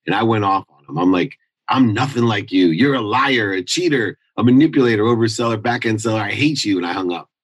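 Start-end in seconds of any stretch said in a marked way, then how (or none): 1.7-6.8 reliving the fight with dad, angry; retold angry outburst. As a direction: throw each insult at him.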